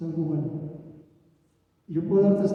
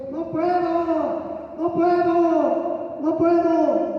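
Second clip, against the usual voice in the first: high, slow anguished pleading; desperate cry; 9 semitones higher, much slower than usual. Retold or imitated. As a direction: cry out in despair, drawn out. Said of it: "le gritaba a Dios".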